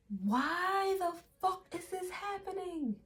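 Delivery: strangled mock scream under the breath; whispered mock scream. The screaming Nine of Swords figure, whispered panic. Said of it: "looks like they're screaming their head off".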